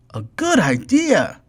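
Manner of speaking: high, bright character voice; Ted's voice. A narrator's reading. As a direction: chirp it like a cheerful friend. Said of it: "said Ted to Fred".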